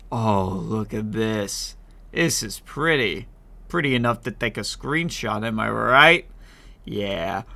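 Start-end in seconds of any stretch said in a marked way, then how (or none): none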